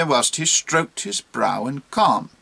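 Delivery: plain reading voice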